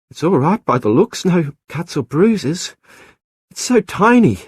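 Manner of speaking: breathless delight over a newborn; relieved wonder. Acted. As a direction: marvel at the tiny baby. Isabel examining the rescued baby.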